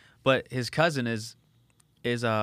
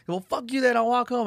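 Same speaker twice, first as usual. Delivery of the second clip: high, louder mock yell of a mouthy kid; retold defiant comeback; 12 semitones higher, 5 dB louder than usual. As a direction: imitate a kid yelling back at his coach. Kid yelling back at the coach.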